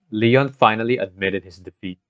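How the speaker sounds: ordinary talk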